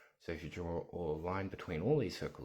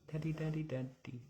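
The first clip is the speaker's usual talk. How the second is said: high sing-song voice; 10 semitones higher than usual. Real, not acted. Coaxing baby to say daddy.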